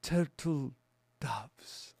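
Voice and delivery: slow, soft, with a pause between the words; hushed, stretched-out character voice; shop owner. Acted. Host retelling the film's ending, slow soft shop owner voice. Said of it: "And he goes".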